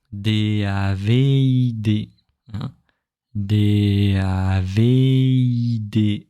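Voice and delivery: slow D A V I D, letters stretched and joined; connected spelling. Shown as a demonstration. Teacher linking the name's letters for students. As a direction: hold one tone through D A V I D. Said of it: "Yeah, connect everything".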